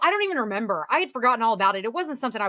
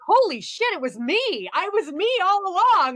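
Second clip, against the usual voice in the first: higher, awestruck realization; amazed Harry; 8 semitones higher than usual. Retold or imitated. Imitating Harry seeing himself, high and amazed. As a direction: blurt it out in sudden wonder. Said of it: "he's like".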